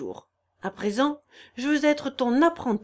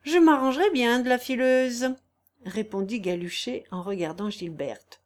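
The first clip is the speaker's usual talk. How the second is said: plain reading voice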